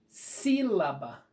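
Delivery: sílaba slowed, with SÍ stretched; SÍ-la-ba, lean on the "sí"; slow teacher demo of "Sílaba", first syllable strong; stress on first syllable. Shown as a demonstration. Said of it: "Então, a última".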